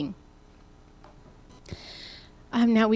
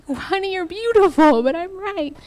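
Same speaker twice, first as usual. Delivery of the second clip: mock loving spouse; 8 semitones higher, 4 dB louder than usual. Imagined sweet couple argument.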